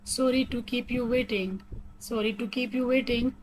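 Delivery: very slow, every word separated; robotic slow reading. Shown as a demonstration. Teacher modelling an apology for lateness, slow and robotic. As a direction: spell it out evenly like a machine. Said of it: "थोड़ा robotic वे में पढ़ के बता रही हूँ".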